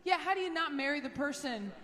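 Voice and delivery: ordinary talk, nothing marked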